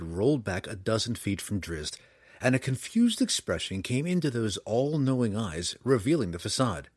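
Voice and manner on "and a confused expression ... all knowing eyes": ordinary delivery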